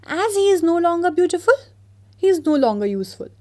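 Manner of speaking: high, loud, fast and dismissive; haughty professor. Acted. Art professor dismissing the statue, loud and haughty. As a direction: sneer it out as a pompous professor. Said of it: "said the art professor".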